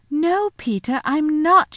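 put-on high, loud child's protest; indignant denial. Lucy denying to her brother it's a game, indignant.

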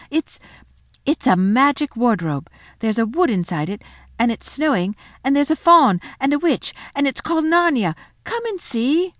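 put-on high, loud, building child's excitement; very excited. Lucy convincing siblings about the magic wardrobe, excited. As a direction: build eagerly to a high invitation. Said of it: "Lucy was so excited".

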